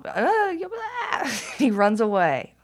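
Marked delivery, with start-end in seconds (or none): 0.0-1.3 high, loud mock tantrum wail; upset son. Mom imitating her upset son before he runs away, mock tantrum. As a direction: imitate a child's wordless outburst. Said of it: "My son does this thing".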